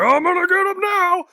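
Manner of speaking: very high mock wail; mock crying. Reteller playing the guy cranking the spinning bed, high mock crying. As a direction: imitate a frantic crying squeal. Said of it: "and then the guy's like".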